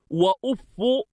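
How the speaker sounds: وأف said "wa-uffu", short u after doubled f; damma on fa. Shown as a demonstration. Teacher showing students وأف as "wa-uffu", fa with damma. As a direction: end وأف on "-fu". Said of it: "بضم الفاء".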